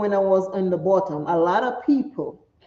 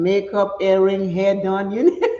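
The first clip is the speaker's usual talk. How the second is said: ordinary talk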